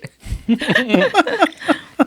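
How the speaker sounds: high burst of laughter; loud laughter. Real, not acted. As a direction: crack up loudly. Laughing at the joke.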